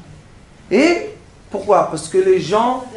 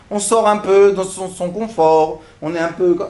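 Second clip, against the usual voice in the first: usual speaking voice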